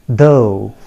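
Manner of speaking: "though" rhyming with go; correct pronunciation. Shown as a demonstration. Teacher telling learners the correct form, "though" rhyming with go. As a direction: say "though" as DOH, long o. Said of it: "I repeat".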